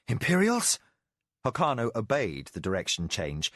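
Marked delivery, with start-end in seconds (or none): 0.1-0.8 sharp, high startled question; alarmed question. Acted. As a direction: blurt it out in alarm.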